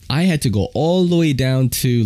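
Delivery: ordinary talk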